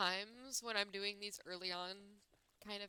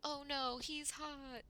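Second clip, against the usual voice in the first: excited gushing; 8 semitones higher, 3 dB louder, much slower than usual. Real, not acted. Reacting to attractive character.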